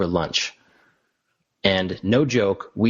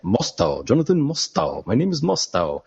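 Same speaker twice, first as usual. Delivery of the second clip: put-on "Mostow" in a playful Spanish accent; 3 semitones higher than usual. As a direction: roll the name with a Spanish accent. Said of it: "I kept saying his name in, like, a Spanish accent".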